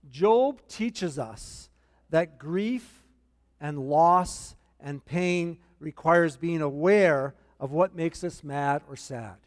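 slow, deliberate note-taking pace; unhurried, word by word for the notes; slow dictation. Shown as a demonstration. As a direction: spell it out slowly so they can write it down. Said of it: "This is in your notes".

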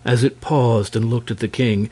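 ordinary delivery

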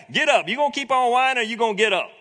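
shouted impatient command; annoyed command. Retold or imitated. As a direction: bark it down at a whiner. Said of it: "talking down to him".

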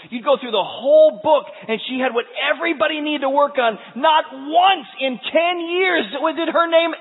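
high, loud, driving sermon; fiery preaching. Acted. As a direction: build it up like a revival preacher. Preaches at full force.

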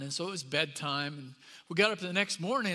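normal talking voice

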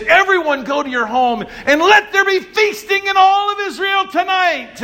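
high, ringing call to feast; joyful proclamation. Acted. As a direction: announce the feast with joy. David proclaims feast to people.

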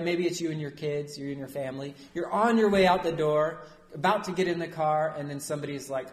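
ordinary delivery

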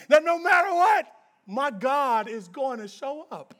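ordinary talk

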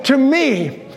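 usual speaking voice

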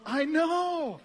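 high, drawn-out exclamation; giddy disbelief. Acted. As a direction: burst out high, as amazed as they are. Criminal shares their amazement in heaven.

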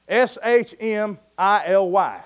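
S H M I L Y spelled out letter by letter, slowly; spelling it out. Shown as a demonstration. Preacher spelling the word for the congregation, slow and deliberate. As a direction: spell out Schmiley one slow letter at a time. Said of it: "I guess how you say it".

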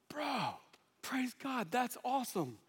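high, soft, elated praise; hushed delight. Retold or imitated. Pastor to cashier on his sobriety, hushed delight.

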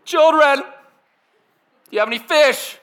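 high, loud call across water; full-voiced distant shout; yelling. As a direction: holler it out to people far off. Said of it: "all of a sudden he yells".

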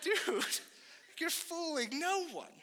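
high-pitched, knowing call-out; mock disbelief. Friend answers the faked cheer, high mock disbelief. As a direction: call his bluff in a high voice.

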